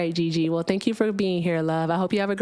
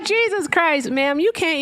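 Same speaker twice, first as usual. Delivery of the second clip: exasperated; 7 semitones higher, 3 dB louder, slower than usual. Real, not acted. Exasperated with insulting caller.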